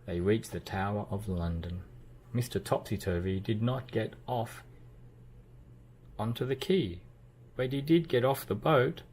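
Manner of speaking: ordinary delivery, nothing marked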